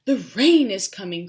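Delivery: loud baboon cry. A narrator's reading. Baboons cry out the rain is coming. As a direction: call it out loud. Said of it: "cried the baboons".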